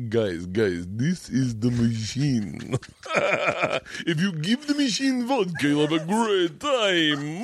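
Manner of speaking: drawn-out, hammed-up Russian accent rising high; vampire-like Russian accent. Comic doing his machine story from stage, vampire-like Russian accent. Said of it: "my Russian accent, uh, sounds like a fucking vampire".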